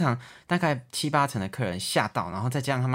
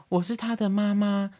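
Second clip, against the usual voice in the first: put-on lightly raised, slow, a young voice posing as a mother; young woman faking mom; 9 semitones higher, slower than usual. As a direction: play a girl pretending to be her own mom. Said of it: "声音听起来就二十几岁的声音". (年轻女生假装是妈妈的语气)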